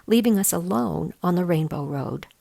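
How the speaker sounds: plain reading voice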